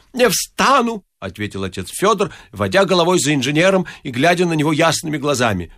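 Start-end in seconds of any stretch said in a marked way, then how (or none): 0.1-1.0 high-pitched wailing refusal; stubborn priest. A narrator's reading. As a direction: refuse in a high, pleading cry. (упрямо, высоким голосом)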